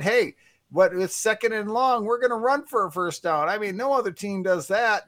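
high, fawning booth-analyst voice; Chris Collinsworth impression. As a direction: gush like a TV color man, pitched well up. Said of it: "good Chris Collinsworth impersonation".

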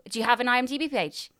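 ordinary delivery

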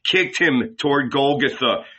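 plain reading voice

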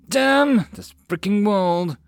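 loud, slow bitter curse; angry curse. Acted. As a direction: spit out the frustration. Cursed after the ordeal.

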